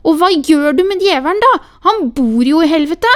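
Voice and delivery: put-on defiant child shouting. A narrator's reading. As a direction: fire the question back at her loudly. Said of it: "ropte Sofia".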